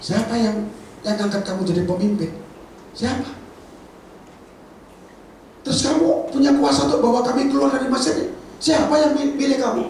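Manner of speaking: high, sneering demand; mocking challenge. The crowd taunting Moses, high and sneering. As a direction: challenge his right to lead. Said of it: "Kalau bahasa saya".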